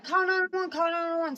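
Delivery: reenacted panicked shout; urgent call-out. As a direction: call out for someone to get help.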